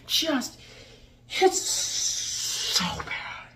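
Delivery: very slow, voiceless drawn-out groan; despairing disgust. Real, not acted. Reviewer despairing at the whiny king, strained exasperation. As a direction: squeeze the words out in dismay.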